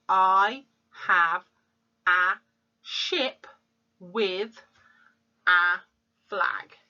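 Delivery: each word said apart with pauses; teacher spacing out the words of the sentence. Shown as a demonstration. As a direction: count the words by pausing after each one. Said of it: "So the sentence is".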